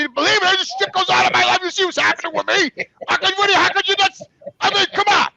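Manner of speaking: high and loud, pleading in disbelief; retold exasperated shout. Son shouting at his mother about the curse, high and loud. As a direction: vent the frustration at her, voice up high.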